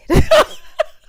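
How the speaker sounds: loud high burst of laughter; real shrieking laugh. Real, not acted. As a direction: cackle out loud.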